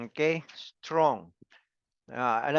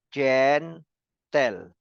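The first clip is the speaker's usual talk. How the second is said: syllable by syllable. Shown as a demonstration. Teacher demonstrating two syllables to the class, said one by one. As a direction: say GEN and TEL as two separate beats. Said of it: "That is two".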